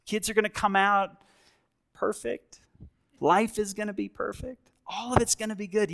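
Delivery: high, fast, starry-eyed gushing; mock the dreamy newlywed, rushing ahead; idealistic gushing. Pastor mocking a starry-eyed engaged couple, high, fast gushing. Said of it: "You have this, this attitude".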